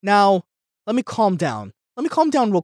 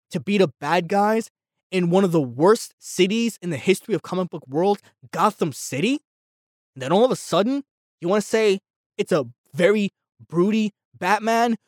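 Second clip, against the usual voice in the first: ordinary talk, nothing marked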